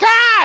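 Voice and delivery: high, loud mock shriek of a girl freaking out; a short hammed-up scream; retelling a horror scene with a mock scream. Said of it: "freaking out, like".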